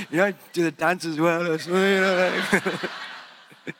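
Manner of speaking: laughing. Real, not acted. Laughing about Mister Bean dance.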